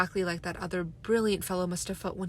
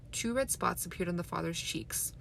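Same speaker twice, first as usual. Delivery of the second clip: plain reading voice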